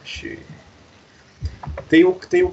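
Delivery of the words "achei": breathy whispered pera aí to himself; whispering. Real, not acted. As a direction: whisper it under your breath while searching. Hunting for the file, muttering.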